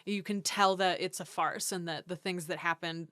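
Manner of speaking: ordinary talk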